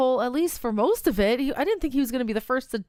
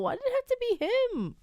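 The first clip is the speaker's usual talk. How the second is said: high, pleading wail; whiny lament; 10 semitones higher than usual. Real, not acted. Player mourning a favorite character, whiny lament. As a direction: whine it high, falling away at the end.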